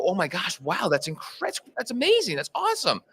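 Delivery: imitating awestruck listeners, pitch climbing; amazed reaction. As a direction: gush in mock amazement. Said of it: "most people go".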